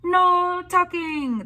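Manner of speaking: very high, loud, slow chorus; crew cry. A narrator's reading. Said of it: "the crew cried".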